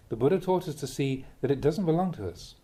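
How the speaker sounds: ordinary talk, nothing marked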